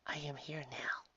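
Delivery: soft, breathy kind angel; an angel soothing the girl in a whisper; gentle angel whisper. Acted. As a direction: whisper comfort to a dying child.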